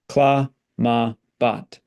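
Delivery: clamabat split into syllables cla, ma, bat. Shown as a demonstration. Teacher spelling out Latin syllables for students. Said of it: "where to put the stress".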